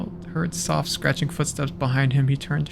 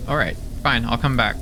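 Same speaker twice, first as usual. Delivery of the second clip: usual speaking voice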